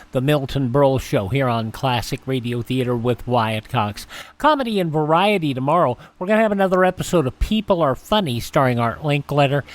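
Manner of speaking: ordinary talk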